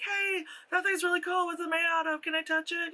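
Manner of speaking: high, sing-song polite request; polite admirer. Acted. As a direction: act out a well-mannered fan asking. Models polite request from a stranger.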